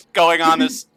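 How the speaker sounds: usual speaking voice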